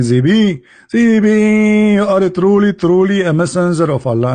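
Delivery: lightly mocking, long-held calling out; mock angel call. Jibreel coming to stop him, mock heavenly call. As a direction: call out the name, then ease down.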